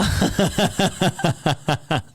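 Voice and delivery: loud, high burst of laughter; hearty laugh. Real, not acted. Host reacts to the Love and Basketball joke, hearty laugh. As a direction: crack up in a rapid, loud cackle.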